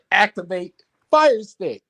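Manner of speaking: hammed-up robot command; Voltron voice. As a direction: call it out like a mecha pilot.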